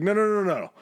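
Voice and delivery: high, loud repeated no; mock panic, calling them off; director stopping them. Director halting the stars' real one-on-one, loud high protest. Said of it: "it's like".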